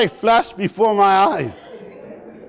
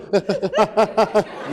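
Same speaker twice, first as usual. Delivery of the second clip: short burst of shuddering sob gasps; mock crying that won't stop; exaggerated juddering sniffly breaths; hitching sobs; 4 dB quieter than usual. Shown as a demonstration. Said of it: "'cause you start doing".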